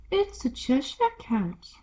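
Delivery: a hint of a haughty duchess, slow; lightly voiced Duchess. Duchess answering Alice, lightly haughty. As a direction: drawl it out a little grandly.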